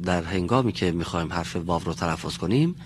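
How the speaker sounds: ordinary talk, nothing marked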